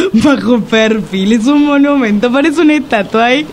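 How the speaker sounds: laughing through the words. Real, not acted. Friend laughing while admiring the doorman. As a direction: giggle while marveling at him. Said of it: "laugh cry".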